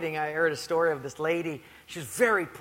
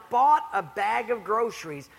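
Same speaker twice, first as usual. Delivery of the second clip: ordinary talk, nothing marked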